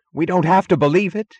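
high, loud, dogged insistence; insistent. A narrator's reading. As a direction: insist as if holding on to a trance. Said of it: "the flat, insistent sound of an effort to maintain a trance".

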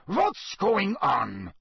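high, slow, drawn-out cry; cried out. A narrator's reading. Karkaroff seeing Krum on the ground, crying out. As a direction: demand it, pitched well up. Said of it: "he cried".

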